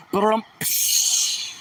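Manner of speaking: voiceless ba-dum-psh drum noise; rimshot imitation. Drummer marking a failed joke with a voiceless rimshot. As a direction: mouth a slow rimshot with a cymbal hiss. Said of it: "hit one drum and then the cymbal".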